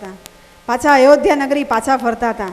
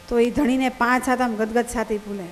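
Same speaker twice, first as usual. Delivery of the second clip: ordinary delivery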